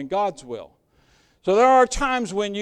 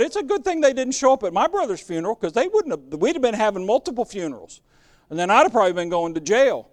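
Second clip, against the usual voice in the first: fast heated aside; 2 semitones higher, faster than usual. Retold or imitated. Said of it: "I thought".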